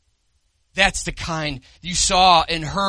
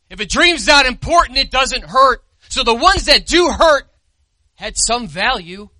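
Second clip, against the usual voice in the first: louder, higher preaching; surging pulpit emphasis; preaching at full force; 5 semitones higher, 7 dB louder than usual. Acted. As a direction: press the point hard. Preacher rises in intensity.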